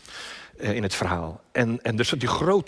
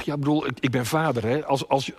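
ordinary talk, nothing marked

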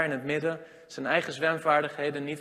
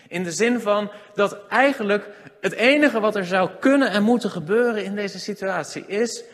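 preaching at full force; 4 semitones higher, 7 dB louder than usual. Real, not acted.

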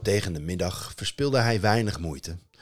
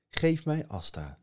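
a god's higher voice; lightly voiced god; 9 semitones higher than usual. Honir asking the parents, higher. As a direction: ask gently from up high.

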